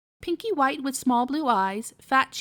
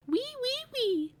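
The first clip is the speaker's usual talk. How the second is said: piglet squeak; 13 semitones higher, 4 dB quieter, slower than usual. Acted. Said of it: "answered Robinson".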